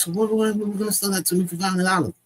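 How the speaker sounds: high, fast retold protest; mock disbelief. Man in the closet balking at the price, mock disbelief. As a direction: rattle it out high, playing incredulous.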